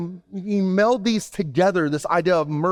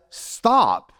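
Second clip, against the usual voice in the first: commanding stop; 6 semitones higher than usual. Acted. Jesus commands the storm.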